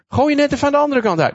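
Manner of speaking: sarcastic fishermen mimicking; mocking quote. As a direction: toss off the advice as absurd. Mocking the stranger's advice.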